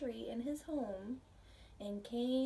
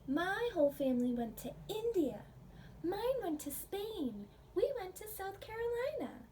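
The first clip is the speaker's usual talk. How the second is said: put-on louder, higher eager children calling out trips; children outdoing each other, louder and higher; excited kids; 8 semitones higher, 4 dB louder than usual. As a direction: pile up the kids' answers, each one higher. Children in story list trips.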